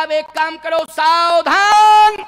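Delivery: high, drawn-out herald's call; loud proclamation. Acted. King Janak addressing the assembled kings, loud proclamation. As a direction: call the assembly to attention, stretching the last word.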